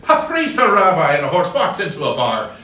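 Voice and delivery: high, loud, lively opening; confident joke teller. Retold or imitated. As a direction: launch the joke like a gifted preacher. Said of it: "he would get up and say".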